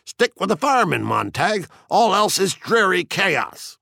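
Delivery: high-pitched, grandiose quoting; taunting fire captain. A narrator's reading. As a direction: declaim quotations to needle him. Narrator voices Beatty's mock debate.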